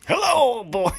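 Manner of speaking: high, loud cackling ghoul greeting; Crypt Keeper voice. Pretend. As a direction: croak it like a campy ghoul host. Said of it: "you wanna do that breakdown? Or that opening?".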